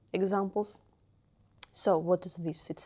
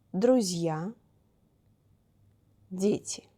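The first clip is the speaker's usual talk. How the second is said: louder, with long pauses between Города and дети; teacher reading a word list; 4 dB louder than usual. Acted. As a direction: read each plural slowly for students to repeat.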